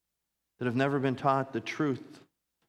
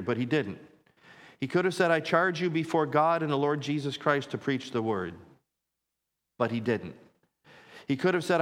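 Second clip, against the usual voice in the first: louder, higher pulpit delivery; forceful preaching; 3 semitones higher, 4 dB louder than usual. Real, not acted. Pastor building up Paul's charge to Timothy, forceful preaching. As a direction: drive each quoted charge home.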